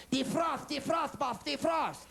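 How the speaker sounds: Tattoo from Fantasy Island. Retold or imitated. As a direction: shout it like Tattoo. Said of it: "press the defrost".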